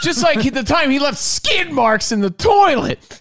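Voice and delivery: high, loud and slow mom mockery; teasing mom voice. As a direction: drag out the tease like a gleeful mom. Mom teasing relative again.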